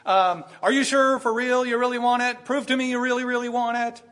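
hammed-up doubting gatekeeper; mock demanding. Preacher mocking what Jesus never said, suspicious. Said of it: "Jesus doesn't say".

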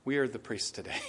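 soft, subdued repeat; quiet. Real, not acted. Preacher repeating his point to the congregation, softly. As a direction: say it low, as if convicted. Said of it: "I even say it quiet".

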